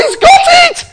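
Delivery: very high, loud cry of delight; overjoyed cry. Retold or imitated. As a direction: burst out as if the news just landed. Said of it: "I thought".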